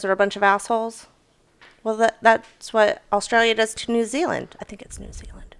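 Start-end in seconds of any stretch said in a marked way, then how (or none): 4.6-5.5 whispered aside; whispering. Real, not acted. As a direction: whisper it to yourself. Unsure aside.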